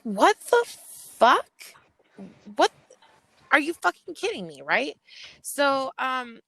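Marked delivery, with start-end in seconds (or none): 0.0-4.6 much higher and louder, shocked yell; reliving a furious shout at the accusation; retold outrage. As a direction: let the disbelief explode.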